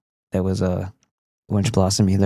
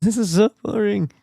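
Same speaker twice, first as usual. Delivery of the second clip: high whining complaint, softer; mock whiny viewer; 10 semitones higher, 4 dB quieter than usual. Streamer voicing a bored viewer, high whiny complaint. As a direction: whine like a bored kid. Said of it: "torturing you like Cartman".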